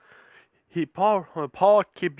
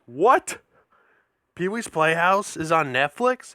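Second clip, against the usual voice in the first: caught off guard; 2 semitones higher than usual. Retold or imitated. Hearing the Netflix news, acted surprised disbelief. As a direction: repeat the news back in disbelief. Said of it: "That caught me off guard".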